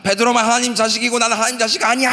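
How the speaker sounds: high, crying protest with a sob in it; tearful high complaint, voice breaking; sobbing wail. Retold or imitated. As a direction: wail it like a hurt child to God. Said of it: "하면서 막 울고불고".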